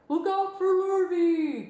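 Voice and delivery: high, loud, slow cow call. A narrator's reading. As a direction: call out in a big mooing voice. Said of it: "called the cows".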